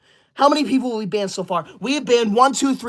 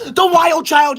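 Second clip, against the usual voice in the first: higher, forceful yell of the name; angry call-out; 5 semitones higher, 4 dB louder, slower than usual. Real, not acted. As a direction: call out the offender angrily. Banning the offending viewer.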